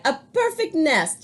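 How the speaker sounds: a hen thrilled by the nest; delighted chicken. A narrator's reading. Hen admires the nest in a high voice. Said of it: "she cried".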